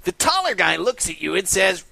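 ordinary talk

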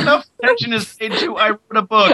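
high-pitched mock rant into a scream; a jokey high scream, rushed at first. Planning a call until she hangs up, mock screaming. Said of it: "How long should I scream?".

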